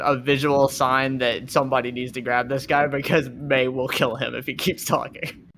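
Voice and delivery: normal talking voice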